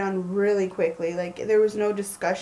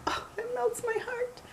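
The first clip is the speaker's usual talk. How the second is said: breathy high whisper, melting; swooning whisper; 13 semitones higher, 9 dB quieter than usual. Retold or imitated. Wife retelling her reaction to the romantic surprise, swooning whisper. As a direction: whisper it like your heart is melting.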